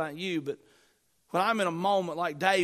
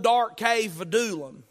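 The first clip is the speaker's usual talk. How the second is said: ordinary delivery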